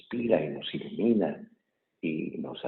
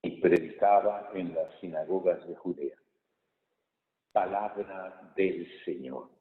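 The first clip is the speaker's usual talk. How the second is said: raised, solemn proclamation; gospel reading; 4 semitones higher, 3 dB louder than usual. Real, not acted. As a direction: read it out to the whole church.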